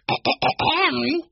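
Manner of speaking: high, acted "ahem"; pointed throat-clearing. A narrator's reading. Benny interrupts Joshua with a high, pointed ahem. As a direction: clear your throat for attention. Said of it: "Benny cleared his throat".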